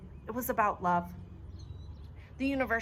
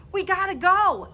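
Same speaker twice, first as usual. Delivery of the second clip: high, loud urgent scream; desperate shout; 11 semitones higher, 9 dB louder than usual. A narrator's reading. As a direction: yell to get everyone moving. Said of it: "I scream".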